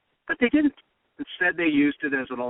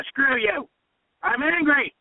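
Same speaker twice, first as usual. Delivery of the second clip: mock defiant yell; 10 semitones higher, slower than usual. Host acting out the answer he wanted, defiant yell. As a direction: blow up like a fed-up candidate. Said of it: "They would have said".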